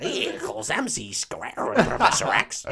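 hammed-up bad American accent; Sophie Turner. Podcaster doing Jean Grey to Professor X, bad American accent. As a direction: put on a goofy, badly faked American accent. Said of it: "I've sort of gone with that".

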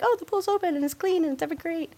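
high, gushing excitement; mock delight. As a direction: gush in a high imitated cheer. Acts out delight at reopened pool.

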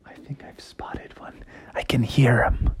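very soft stealthy hunter's whisper; secretive, barely-there whisper; hushed whisper. Acted. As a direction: whisper it as if the prey might hear. Frog whispers on spotting prey.

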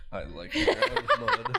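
put-on high, slow childlike voice; squeaky mock of a friend; mocking impression. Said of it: "You're like".

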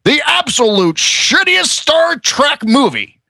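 high slow comedian sneer; Neil Hamburger voice. Shown as a demonstration. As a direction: drag out the sour comic line. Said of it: "in my Neil Hamburger voice".

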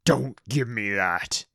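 slow, menacing orc boss voice; suspicious orc boss. Acted. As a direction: drag the words out like a distrustful orc. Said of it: "The towering orc looked round suspiciously".

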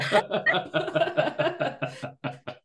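long high chuckle; laugh. Real, not acted. Host joking about orbiting a star, long high laugh. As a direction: laugh at your own joke in a long run.

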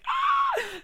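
sudden high shrieking laugh; burst of laughter. Real, not acted. Telling the awkward story, bursting out laughing.

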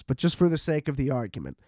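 ordinary delivery

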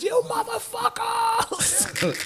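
drawn-out high rock-frontman scream. Retold or imitated. Rock singer greeting his audience on stage, screamed. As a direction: scream it long and high, like greeting a concert crowd. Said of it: "The first thing he said was".

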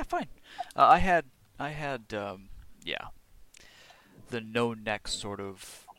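ordinary talk

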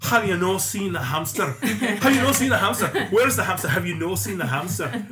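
loud, high Scottish calling for the hamster; mock Scottish search, calling out high; Scottish accent. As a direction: hunt the flat in a broad Scottish brogue. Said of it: "the phrase".

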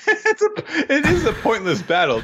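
words squeezed out through high-pitched giggles; cracking up at a pointless battle; laughing. Real, not acted. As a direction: laugh while trying to finish the joke. Co-host bursts out laughing.

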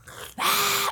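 hammed-up gasp; mock shocked fan gasp. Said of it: "Star Wars fans are like".

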